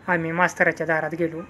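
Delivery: usual speaking voice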